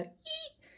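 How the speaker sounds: very high, quiet squeak; imitated record scratch. Tarot reader mimicking a needle scratching on a record, high squeak. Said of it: "the sound of a needle scratching on a record".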